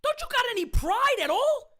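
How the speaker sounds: ordinary talk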